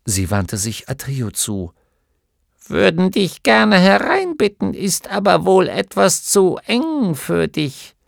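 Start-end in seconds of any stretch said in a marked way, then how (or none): 2.7-8.0 put-on bossy old healer brushing off her husband; resolute gnome wife. A narrator's reading. (Gnomenweib fährt ihren Mann an, höher und lauter)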